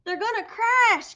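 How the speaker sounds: high, loud, slow alarm; alarmed gasp. A narrator's reading. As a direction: gasp then resolve to rescue. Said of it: "gasped Stellaluna".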